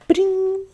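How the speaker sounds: a squeaky high spell sound; ping the magic like a bell. A narrator's reading. Spell takes effect, tiny high magic ping.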